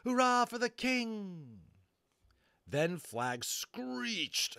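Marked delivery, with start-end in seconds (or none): 0.1-1.6 high, slow triumphant cheer; joyful cheer. A narrator's reading. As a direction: shout it for the king. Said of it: "Dennis cried, grinning dizzily".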